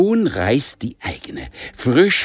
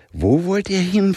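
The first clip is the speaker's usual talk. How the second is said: higher, incredulous question; can't believe her ears; disbelieving; 10 semitones higher than usual. A narrator's reading. Mother asking where they fly, disbelieving. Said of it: "fragte Mom ungläubig".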